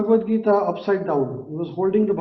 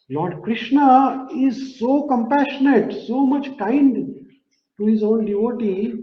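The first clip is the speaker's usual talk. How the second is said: much higher and slow, swelling with devotion; fervent praise; 6 semitones higher, slower than usual. Real, not acted. Preacher telling the congregation of Krishna's kindness, fervent and slow. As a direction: lift the voice in awe of his kindness.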